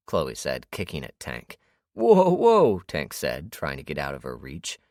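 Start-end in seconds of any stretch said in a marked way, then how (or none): 1.9-2.8 high, loud backing-off yelp; startled whoa. A narrator's reading. Tank dodges Chloe's kicks, startled loud whoa. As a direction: dodge and protest at once.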